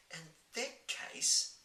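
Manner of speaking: put-on young girl voice. Sophie asks.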